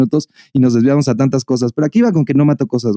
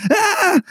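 yelp. Retold or imitated. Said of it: "Me metí un vergazo".

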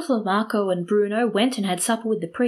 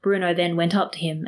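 ordinary delivery

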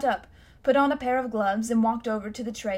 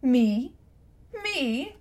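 indignant, rising Me?; an old man stung by a question; offended exclamation; 3 semitones higher, 3 dB louder than usual. Acted. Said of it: "he exclaimed".

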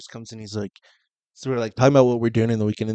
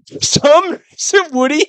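incredulous; 11 semitones higher, slower than usual. Real, not acted. Incredulous comment, high.

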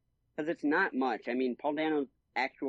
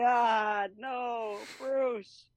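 much higher, wailing mock despair; distraught Riddler; 9 semitones higher, much slower than usual. Riddler breaking down when Batman rejects him, hammed-up wail. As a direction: wail it like a broken villain. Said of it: "he's just like".

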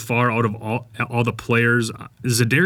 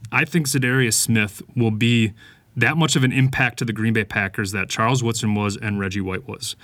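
usual speaking voice

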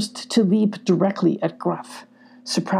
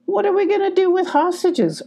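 much higher badger voice, protesting; arguing badger; 13 semitones higher than usual. A narrator's reading. The Badger arguing with Gruff, much higher. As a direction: push back, pitched up. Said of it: "argued the Badger".

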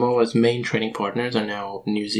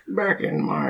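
put-on slow old storyteller voice, higher; creaky old man recounting history, slowly; 6 semitones higher, slower than usual. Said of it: "do your old man history voice".

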